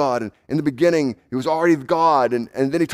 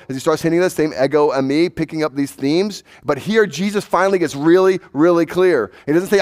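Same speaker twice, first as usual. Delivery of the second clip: ordinary delivery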